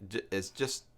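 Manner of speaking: ordinary talk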